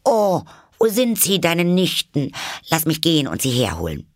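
put-on high, loud eager child; curious excitement. A narrator's reading. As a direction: burst out, then rush on eagerly. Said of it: "sah sich neugierig überall um".

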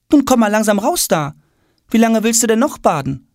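high-pitched mother calling in; mother's voice. A narrator's reading. Mother at the bathroom door, chiding in high voice.

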